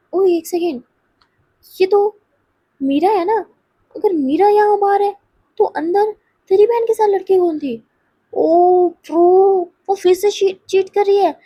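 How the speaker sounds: high, loud dawning disbelief; acted gasping-out of a scandalous discovery; shocked realisation. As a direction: piece it together aloud, astonished. Acted friend realises who is cheating.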